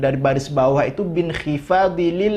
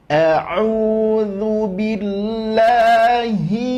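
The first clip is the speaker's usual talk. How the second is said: slow chanted a'udzu billahi; Quran recitation; 5 semitones higher, 3 dB louder, much slower than usual. Shown as a demonstration. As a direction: recite it for the class. Recites for students. (bacaan tartil pelan)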